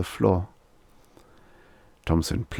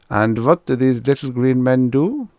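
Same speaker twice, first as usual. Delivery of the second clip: louder, higher German doctor voice; German accent; 6 semitones higher, 5 dB louder than usual. Acted. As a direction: ask it like a German shrink. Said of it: "a German accent".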